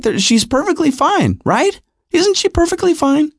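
high-pitched, pleading for agreement; desperate denial. Retold or imitated. Father anxiously seeking agreement in denial.

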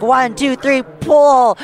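counting shout. Retold or imitated. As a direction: call the heave like hauling a rope. Hauling the fish together.

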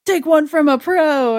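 lightly voiced cartoon hero one-liner; Cyclops. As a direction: build to a loud, high boast. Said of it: "Cyclops shows up and he's like".

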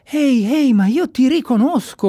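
excited shout. Acted. Pink shouts to the girl he recognises, excited. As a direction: yell it out as you recognise her. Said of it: "urlò Pink rivolto a quella bambina".

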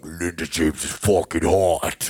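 slow, low voice of gushing commenters; online commenters. Retold or imitated. Host quoting video comments to a friend, put-on commenter voice. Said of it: "all the comments were like".

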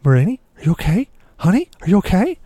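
a hint of a sleepy, anxious wife calling out; worried wife. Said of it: "and she's like".

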